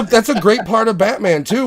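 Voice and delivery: ordinary talk, nothing marked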